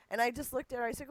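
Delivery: usual speaking voice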